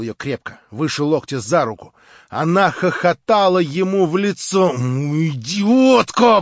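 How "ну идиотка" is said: slow, grinding insult; grinding out in anger. A narrator's reading. Stavrogin curses Marya, slow angry rasp. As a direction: grind the word out through rage. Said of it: "проскрежетал Николай Всеволодович".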